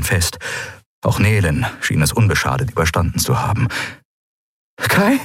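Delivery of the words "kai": high, breathless call of a name; gasped question. A narrator's reading. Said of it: "keuchte Endriel".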